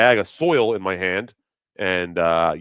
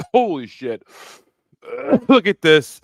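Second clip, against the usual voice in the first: ordinary talk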